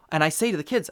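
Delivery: usual speaking voice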